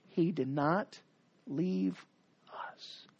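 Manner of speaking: trailing into a whisper. Acted. Preacher to Israel, fading into a whisper. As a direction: fade to a hush. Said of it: "the preacher's going up here and saying".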